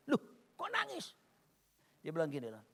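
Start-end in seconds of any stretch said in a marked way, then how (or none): 0.1-1.2 high, soft startled question; taken aback. Retold or imitated. Husband startled that his wife cries, taken aback. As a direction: ask it in hushed, high-pitched surprise. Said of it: "Saya kaget kan".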